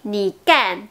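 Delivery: "ni kan" turned into ni gan; no puff at the start of "kan"; unaspirated G. Shown as a demonstration. Teacher showing how the word changes, G sound in "ni kan". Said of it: "ça devient".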